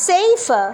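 সেফার with a long ei; safer demo. Shown as a demonstration. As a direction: model সেফার as seifer. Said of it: "উচ্চারণটা হচ্ছে সেফার". (সেইফার উচ্চারণে)

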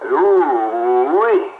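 ordinary talk, nothing marked

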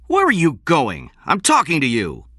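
loud, high indignant challenge; angry demand. A narrator's reading. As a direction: call angrily after someone walking out. Benjamin demands as King walks away.

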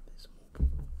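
hushed pointing whisper. Acted. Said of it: "said his mum".

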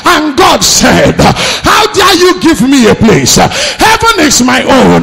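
slow, loud, high proclamation; thundering preaching. Acted. Preacher voices God rebuking Jeroboam over the altar, thundering preaching. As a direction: hurl each sentence out as God's rebuke.

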